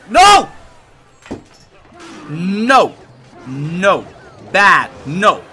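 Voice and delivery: angry shouts of no; furious outburst; raging gamer. Real, not acted. Losing a tower, yelling.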